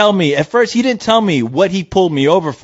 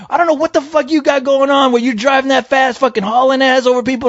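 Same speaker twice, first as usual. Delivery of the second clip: high-pitched mock tirade; retold yelling; 10 semitones higher than usual. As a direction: imitate an irate cop ranting, without much volume. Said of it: "all you wanna do is yell at me".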